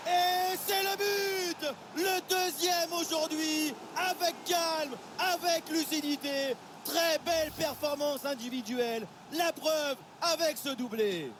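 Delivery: high, slow, drawn-out goal call; excited sports commentary. Real, not acted. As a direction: stretch out the goal call in delight. Second goal called.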